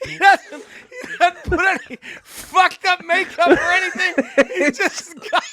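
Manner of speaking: high-pitched, overcome with laughter; laughing hard. Real, not acted. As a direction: struggle to finish through the giggles. Cracking up at the bit.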